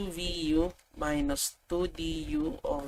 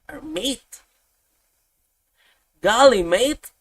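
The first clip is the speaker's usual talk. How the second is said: put-on a loud, higher jokey "Golly, mate" in some foreign accent; mock accent; 6 semitones higher, 10 dB louder than usual. Teacher jokes mid-lesson in an unnamed mock accent. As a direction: toss off a silly accent, getting louder on "Golly". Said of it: "I don't know what accent is that".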